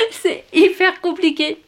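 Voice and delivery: laughing high at the struggle. Real, not acted. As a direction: giggle through it, slowly. Essaie de lire allongée. (rire aigu en galère)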